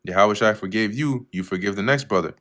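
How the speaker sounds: ordinary delivery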